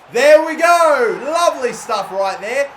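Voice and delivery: high, loud, slow burst of joy; excited cheer. Real, not acted. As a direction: cheer the goal. Goal celebration in his match.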